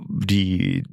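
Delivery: ordinary delivery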